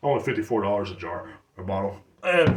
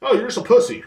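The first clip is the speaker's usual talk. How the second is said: stepdad quote; 12 semitones higher, 8 dB louder than usual. Retold or imitated. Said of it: "He's like".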